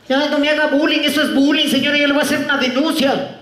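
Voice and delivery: high, rapid-fire mock outrage; hammed-up threat to report; indignant protest. Tenant protesting bullying.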